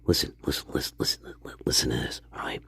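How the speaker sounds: hushed and urgent; whispered listen listen; conspiratorial whisper. Acted. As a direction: lean in and whisper the warning.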